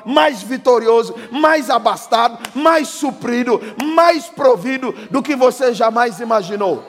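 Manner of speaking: loud, high rising proclamation; full-force preaching. Acted. Preacher prophesying blessing on the congregation, loud and rising. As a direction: build the blessing over the crowd.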